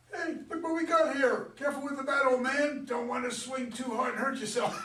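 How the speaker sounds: put-on sneering high teenage punk, pushed loud; taunting teenage boy. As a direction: jeer at the old man like a smug teenager. Narrator voices taunting teenage boy.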